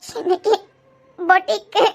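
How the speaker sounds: tearful, halting confession; weepy, choked-up admission; crying. Acted. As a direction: get the words out between sobs. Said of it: "பின்ன அழுதுகிட்டே இரு".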